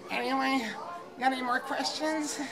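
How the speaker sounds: high, loud excitable nerd voice; fat otaku voice. Acted. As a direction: keep gushing like the basement fan. Keeps the otaku voice going.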